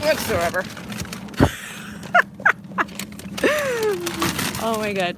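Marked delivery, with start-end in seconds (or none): 0.7-4.6 long high burst of laughter; big laugh. Real, not acted. Laughing at having done no work today, high and long. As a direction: crack up at herself.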